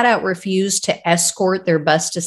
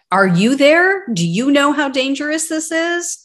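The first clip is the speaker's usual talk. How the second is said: exasperated; 7 semitones higher than usual. Real, not acted. Host mocks dispatcher's indifference.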